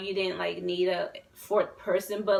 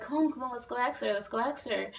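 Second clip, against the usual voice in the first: quick, higher-pitched urging; hurried coaxing; 4 semitones higher, 3 dB quieter, faster than usual. Retold or imitated. Retelling coaxing a scared friend to ask a girl, hurried urging. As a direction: nudge a shy friend along in a rush.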